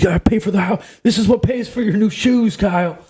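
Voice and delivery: high-pitched bitching dad; Shane Gillis impression. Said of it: "he starts bitching, like".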